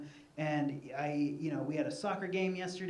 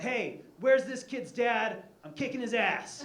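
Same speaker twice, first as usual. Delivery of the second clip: retold yell; 6 semitones higher, 5 dB louder, slower than usual. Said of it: "I yell out".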